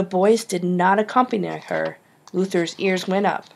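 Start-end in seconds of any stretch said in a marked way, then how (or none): none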